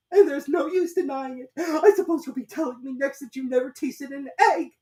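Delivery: very high, loud, tearful bird voice; accusing pigeon. A narrator's reading. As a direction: insist she's a serpent.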